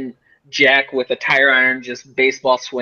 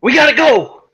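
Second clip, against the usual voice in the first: much higher, loud, frantic; urgent shout; 12 semitones higher, 7 dB louder than usual. Acted. Urging the group to flee the animal attack, shouting. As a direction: yell to get everyone moving now.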